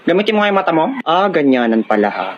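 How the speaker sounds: normal talking voice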